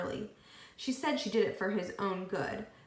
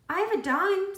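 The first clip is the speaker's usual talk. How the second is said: put-on eager child; 11 semitones higher, 7 dB louder than usual. A narrator's reading. Child voiced in story.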